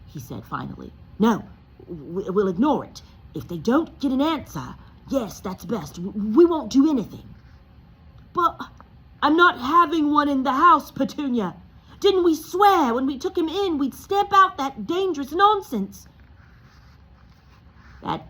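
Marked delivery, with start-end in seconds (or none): 1.2-7.3 louder, nervous stammer; stammering husband. Acted. Uncle Vernon to Petunia, a nervous stammering plan. 8.3-16.1 high, loud vehement insistence; vehement husband. Acted. Uncle Vernon to Petunia, high and vehement.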